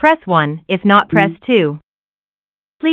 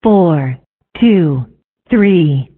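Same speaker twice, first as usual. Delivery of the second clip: spliced digit readout; 3 semitones lower, 3 dB louder, much slower than usual. Acted. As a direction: separate recorded number clips, each falling. Expiry digits read one by one.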